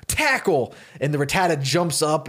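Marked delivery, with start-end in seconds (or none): 0.1-0.7 high, sudden attack call; flustered command. Retold or imitated. Flustered Ben ordering his little Rattata to attack, hurried cry. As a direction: blurt the battle order, flustered. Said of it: "Ben gets flustered".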